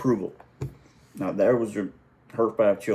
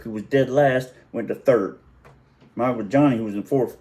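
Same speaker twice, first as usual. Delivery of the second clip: ordinary talk, nothing marked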